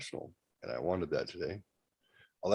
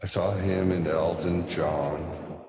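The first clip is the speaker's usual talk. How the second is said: ordinary talk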